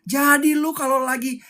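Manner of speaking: ordinary delivery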